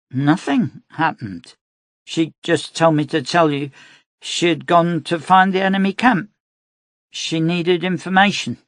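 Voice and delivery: put-on high girl's voice; a little girl explaining plainly; young girl. A narrator's reading. Child voice in story.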